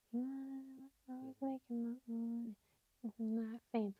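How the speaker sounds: barely audible lyric recall, almost under the breath; quiet mumble, feeling for the lyric; murmured to herself. Real, not acted. Half-remembering the lyric to herself.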